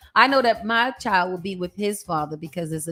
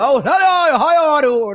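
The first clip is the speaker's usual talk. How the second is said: loud, booming radio host greeting; put-on DJ voice; 3 semitones higher, 8 dB louder than usual. Shown as a demonstration. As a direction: project it like you own the mic. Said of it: "That's not what she sound like".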